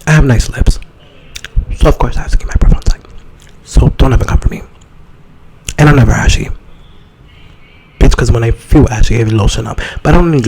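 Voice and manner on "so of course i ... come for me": whispered, a little louder than a secret. Acted. Whispering a boast about her breath to listeners. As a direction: hush it like a confident secret. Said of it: "whispered boast".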